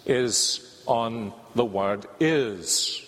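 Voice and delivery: slow, weighty stress on the word "is". Shown as a demonstration. Preacher stressing "is" for the congregation, slow emphasis. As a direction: lean hard and slow on "is". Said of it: "the emphasis in this series".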